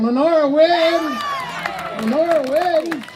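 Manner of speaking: high, drawn-out triumphant shout; game-show victory holler; winner announcement. Real, not acted. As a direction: proclaim the winner to the whole hall.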